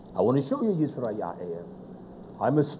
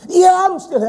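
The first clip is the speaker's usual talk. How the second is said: sister's voice; 10 dB louder than usual. Retold or imitated. Sister's voice quoted.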